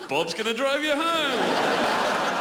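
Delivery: sing-song it like a silly ad jingle; mock cheery ad line; mocking Belgian slogan. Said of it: "and it's".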